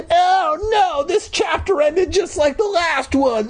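very high squealed mock wail; mock horror. Reader at the chapter's cliffhanger, mock-horrified wail. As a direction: wail it far up high, hammed up.

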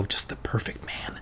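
breathy whispered aside. Acted. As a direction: whisper it dreamily. Dreamy aside.

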